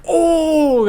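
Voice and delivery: a sudden high, loud "Oh!"; crowd gasp. Retold or imitated. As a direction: gasp out like a shocked audience. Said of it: "my whole theater was like".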